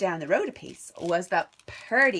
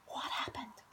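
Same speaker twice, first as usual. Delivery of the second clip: hushed alarmed question; whisper; 2 semitones higher, 13 dB quieter than usual. Real, not acted. Teacher whispering to children, alarmed. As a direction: whisper like something's wrong.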